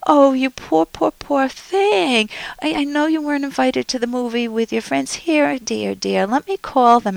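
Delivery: hammed-up doting parent fussing over a child; mock-gushing smothering mother. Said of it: "a parent may say to you".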